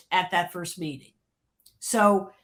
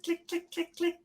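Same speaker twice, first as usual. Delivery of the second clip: boot click sound; 7 semitones higher, 9 dB quieter than usual. Retold or imitated. Imitating boot heels.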